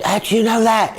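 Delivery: high, startled retold question; freaked-out disbelief. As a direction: imitate someone shocked and freaked out. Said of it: "It freaked everybody out".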